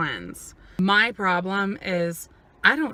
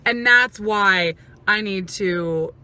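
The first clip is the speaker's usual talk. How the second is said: ordinary talk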